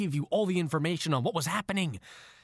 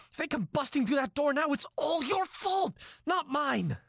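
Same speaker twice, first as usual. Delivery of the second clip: high, strained angry ranting; furious, blaming her, pitched high; frustrated; 9 semitones higher than usual. Acted. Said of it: "in frustration".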